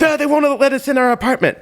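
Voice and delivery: high, indignant complaint; combative wife. Retold or imitated. Wife complains to guide dog official, combative. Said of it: "who loves to fight".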